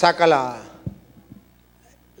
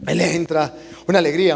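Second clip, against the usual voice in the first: ordinary delivery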